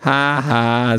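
miffed, sarcastic fake laugh. A narrator's reading. Quality Pad answers Pink's threat, miffed. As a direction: say the laugh as offended words, in character. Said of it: "sagt das Quality Pad eingeschnappt".

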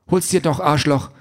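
high-pitched angry taunt; indignant, insulting challenge; defiant wife. A narrator's reading. Wife defying angry husband.

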